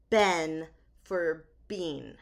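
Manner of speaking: "Ben" with short e, "bean" with long ee; Ben versus bean. Shown as a demonstration. Cohost shows host her two ways of saying been, "Ben" then "bean". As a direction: slowly set "Ben" against "bean". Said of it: "I forget to say".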